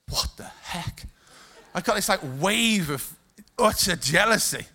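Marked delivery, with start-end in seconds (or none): 0.1-1.1 breathy, voiceless disbelief; stunned whisper. Retold or imitated. Stunned reaction to being second favorite dad.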